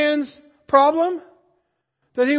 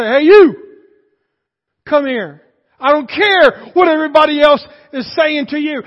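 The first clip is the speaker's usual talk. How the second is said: loud call across a crowd; voiced Jesus calling out loudly; 7 dB louder than usual. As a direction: shout to be heard over everyone.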